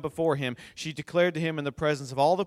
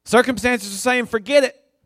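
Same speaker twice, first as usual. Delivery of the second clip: fervent preaching; 7 semitones higher, 7 dB louder than usual. Acted. Preaching at full force.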